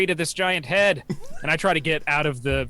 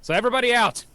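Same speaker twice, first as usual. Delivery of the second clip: announcing; 8 semitones higher, 4 dB louder than usual. Acted. Announcing arrival to the party.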